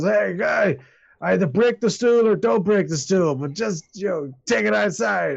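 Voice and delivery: put-on slurring, angry drunk; drunken slurred anger. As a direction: snarl at him like a drunk spoiling for a fight. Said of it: "slurring his words and kinda getting angry".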